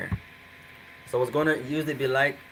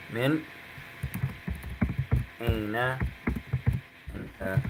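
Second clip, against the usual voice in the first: word by word; 3 semitones lower, 5 dB quieter, faster than usual. Shown as a demonstration. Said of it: "You can say that".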